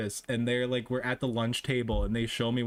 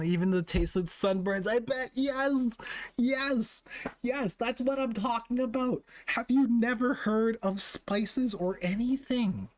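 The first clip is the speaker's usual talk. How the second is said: high mocking voice; 10 semitones higher, slower than usual. Mocking bland food, voice high.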